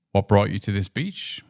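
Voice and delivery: normal talking voice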